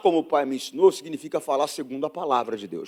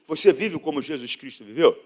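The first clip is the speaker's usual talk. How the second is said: usual speaking voice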